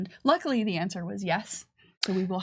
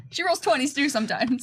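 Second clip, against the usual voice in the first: laughing it out, pitched up; 7 semitones higher than usual. Real, not acted. Joking to co-host about Alexa's dice, laughing. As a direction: chuckle through the line.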